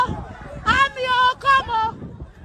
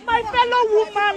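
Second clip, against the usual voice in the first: normal talking voice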